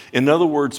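ordinary delivery

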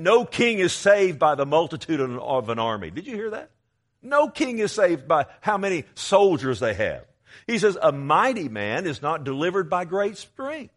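loud, rising pulpit delivery; fiery sermon cadence, loud and high; preaching at full force. Real, not acted. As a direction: thunder the scripture out to the church.

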